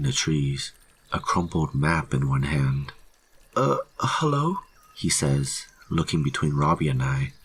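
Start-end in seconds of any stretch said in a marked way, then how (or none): 3.5-4.6 high, hesitant voice; hesitant hello with a questioning lift; anxious greeting. A narrator's reading. Lost hiker greeting two armed rangers, high and anxious.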